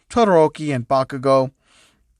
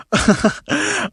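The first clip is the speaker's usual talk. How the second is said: high giggle; 13 semitones higher than usual. Real, not acted. Laughing at own art.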